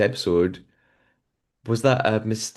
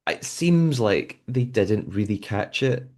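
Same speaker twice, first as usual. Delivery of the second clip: ordinary talk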